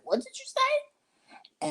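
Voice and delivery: very high, slow mock challenge; mock confrontation. Imitating the friend snapping at her. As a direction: stretch it out, very high and accusing.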